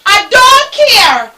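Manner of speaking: mock indifferent snap; slow cold refusal. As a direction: drag it out, refusing him. Mother refusing thirsty son.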